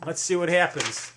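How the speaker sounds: ordinary delivery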